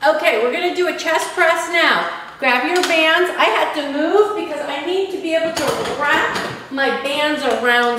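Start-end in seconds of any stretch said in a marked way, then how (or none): none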